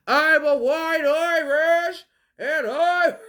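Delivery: loud, slow, high mock proclamation. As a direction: proclaim it slowly, breaking into laughter.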